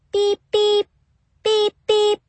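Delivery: high, electronic keypad beeps; imitated beeps. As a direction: beep like a door keypad.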